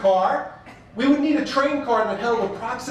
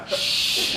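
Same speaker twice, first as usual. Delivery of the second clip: unvoiced shush from the crowd. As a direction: hiss a sharp shush at him. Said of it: "four people look at me and go".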